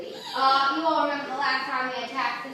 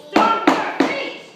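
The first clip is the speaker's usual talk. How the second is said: louder, higher rallying cry; rousing call; 10 semitones higher, 10 dB louder, much slower than usual. Acted. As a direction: whip the crowd up. Host rouses crowd to yell.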